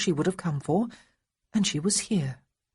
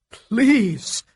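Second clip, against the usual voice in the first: loud desperate plea; begging; 5 semitones higher, 7 dB louder than usual. A narrator's reading. Feverish Marcus to Honoria, loud begging. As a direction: beg her, near tears. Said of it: "He was begging".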